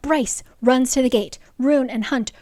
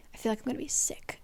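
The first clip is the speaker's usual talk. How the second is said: fast nervous whisper; hushed, quick, queasy aside; whispered nerves; 11 dB quieter, much faster than usual. Real, not acted. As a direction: confess it under your breath. Nervous before the big ending.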